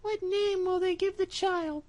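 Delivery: a trusting mouse sending him off; little mouse. Acted. Mouse asks.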